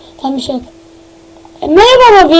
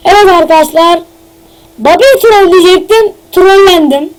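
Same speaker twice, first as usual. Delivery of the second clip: usual speaking voice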